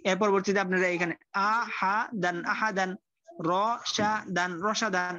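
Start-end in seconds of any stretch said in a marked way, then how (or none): 1.8-5.1 patient teacher spelling out syllables. Shown as a demonstration. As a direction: break it up: হা, দান, then say আহা দান joined. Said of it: "এরকম ভাবে এটা পড়বেন".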